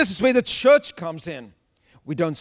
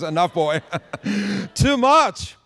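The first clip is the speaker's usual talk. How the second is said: laughing mock rebuke; 2 semitones higher than usual. Jesus stops Peter, joking.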